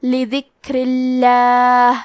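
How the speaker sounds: لذکر اللہ with a light, thin ل and ر; لذکر اللہ with thin, light ر and لام, slowly; slowly recited لذکر اللہ, thin ل; thin lam. Shown as a demonstration. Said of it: "حرف اللام کو پتلی آواز میں پڑھنا ہے".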